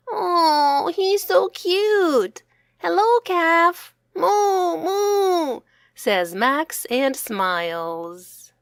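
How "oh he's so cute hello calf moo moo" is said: put-on high, gushing little boy cooing at a calf; delighted child. Max cooing hello to the baby calf, delighted. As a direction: melt over the cute calf.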